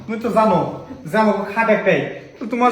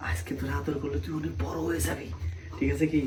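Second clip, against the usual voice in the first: soft sly murmur; hushed coaxing; 8 dB quieter than usual. Acted. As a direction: coax quietly so no one hears. Coaxing sister-in-law in secret.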